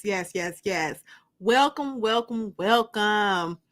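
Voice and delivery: ordinary talk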